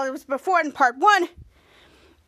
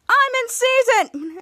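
higher, slow, drawn-out exclamation; excited exclamation; 7 semitones higher, 4 dB louder, much slower than usual. Retold or imitated. Abel crows to the cheering audience, excited retold exclamation. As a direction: crow it slowly, pitched up.

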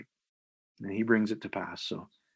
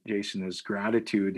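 normal talking voice

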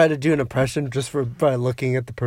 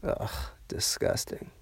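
annoyed ugh; 7 semitones lower, 14 dB quieter, slower than usual. Real, not acted. Disgust at movie.

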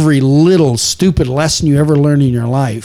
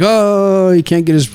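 higher-pitched imitation of an upset child; mock crying; 5 semitones higher than usual. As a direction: imitate a kid whining over a stuck toy. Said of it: "he's crying like".

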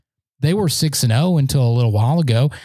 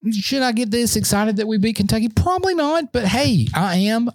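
excited; 7 semitones higher than usual. Real, not acted. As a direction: pitch jumping up, giddy. Excited self-question.

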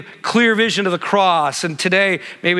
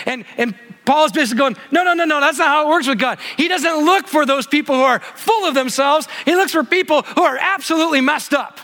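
higher, urgent preaching with rising passion; fired-up preaching; 7 semitones higher than usual. Acted. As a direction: preach it out, lifting the room. Preaches at full force.

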